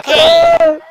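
very high, loud exclamation; sudden yell. Real, not acted. Player reacting to the chaotic mission, high yell. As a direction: burst out far above normal voice.

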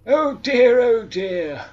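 loud high "Oh dear" fading; loud exclamation. Acted. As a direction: cry it out, then drop. Pun exclamation.

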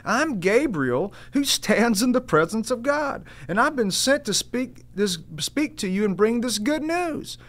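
high angelic herald voice; angel Gabriel. Acted. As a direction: proclaim it as Gabriel. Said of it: "He says this".

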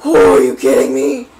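low, loud incredulous cry; can't believe what just happened; outraged disbelief. Real, not acted. Gamer outraged at opponent regaining health, loud disbelief.

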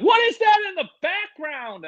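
high squeaky questioning voice; mock high viewer. Viewers asking the host about the background, high squeaky mock. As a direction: parody the puzzled viewer.

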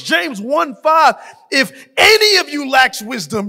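loud, rising preacher's cadence, building with each verse; full-force revival preaching over the organ. Acted. Rapid-fire promise reading, escalating.

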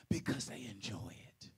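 sudden hushed whisper; a secret told to the room. Real, not acted. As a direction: drop to a whisper for the answer. Whispered punchline after "listen to me.

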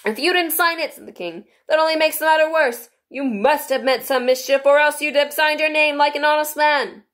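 high, loud pompous king. A narrator's reading. King voiced.

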